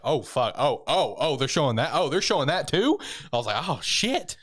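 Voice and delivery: reliving a spoiler jolt; a hint of stunned viewer; retold shock. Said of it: "I'm like".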